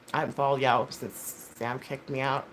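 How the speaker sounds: usual speaking voice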